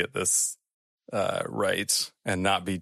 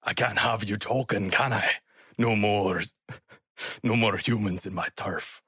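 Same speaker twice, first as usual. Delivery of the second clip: put-on creature accent drifting into Russian; wandering Scottish-to-Russian accent; Scottish turning Russian. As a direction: menace in a muddled Russian-tinged accent. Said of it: "Now he's Russian".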